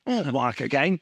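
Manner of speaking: mock Australian-tinged "Oh, my cocaine"; Hugh Jackman impression. Friend teases that the host's accent sounds like Jackman. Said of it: "you Jackman there".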